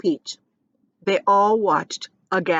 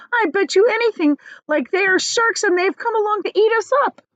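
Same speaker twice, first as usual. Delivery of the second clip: high, louder panicky voice; frightened earthworm; 13 semitones higher, 5 dB louder than usual. Acted. Earthworm warning the others, high and panicky. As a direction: blurt it out in fear.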